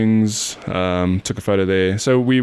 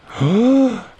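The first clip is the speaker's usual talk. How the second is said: sharp high gasp of realisation; sudden gasp; 14 semitones higher, 3 dB louder than usual. Real, not acted. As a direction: gasp as it comes back. Sudden recall of band name.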